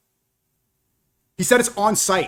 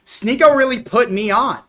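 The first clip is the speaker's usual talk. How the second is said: sarcastic mock; 6 semitones higher, 4 dB louder than usual.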